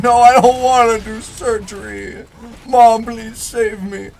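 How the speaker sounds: hammed-up kid begging not to have surgery; pleading child. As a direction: beg mom like a scared kid. Child begging mom, retold.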